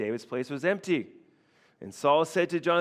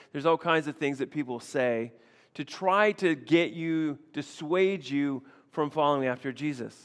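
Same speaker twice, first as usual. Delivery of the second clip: ordinary talk, nothing marked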